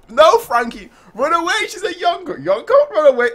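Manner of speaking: high, loud acted alarm; panicked warning. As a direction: shout a frantic mock warning. Acts out panicked warning to Frankie.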